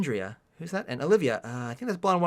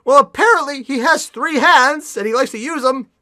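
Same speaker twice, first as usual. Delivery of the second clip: high, loud singsong explanation; loud sarcastic conclusion; mock cheerful sarcasm; 7 semitones higher, 9 dB louder, slower than usual. Real, not acted. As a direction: announce the absurd fact, pitched up. Mocking the plot error.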